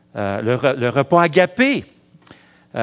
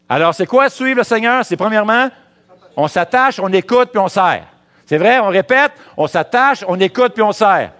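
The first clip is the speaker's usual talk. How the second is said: high, loud preacher's cry; rising sermon fervour; full-force preaching; 8 semitones higher, 5 dB louder than usual. Acted. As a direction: preach it hard to the congregation.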